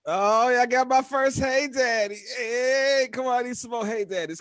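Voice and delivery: high and loud with glee; gleeful, high and loud; excited. Real, not acted. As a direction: celebrate it out loud. Celebrating his first hey daddy.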